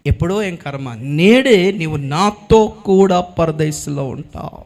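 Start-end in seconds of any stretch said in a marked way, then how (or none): 1.2-4.6 lower, very slow, each word weighed; slow solemn promise. Acted. Jesus assures the dying thief, slow and solemn. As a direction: let the promise land slowly.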